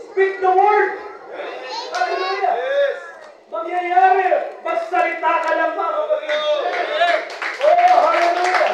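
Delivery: loud, high shouted praise; fiery preacher's exhortation; preaching at full force. Real, not acted. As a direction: lift the room with fervent cries.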